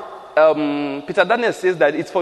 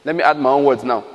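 normal talking voice

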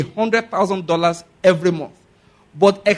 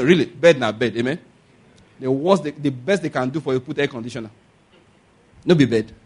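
ordinary talk

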